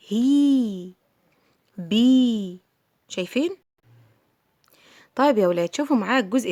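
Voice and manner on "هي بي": "هي، بي" each with a drawn-out vowel; long vowel. Shown as a demonstration. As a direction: stretch "هي" and "بي" at the end. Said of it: "أنا مديت". ("هي، بي" بمد طويل)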